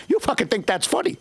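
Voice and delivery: usual speaking voice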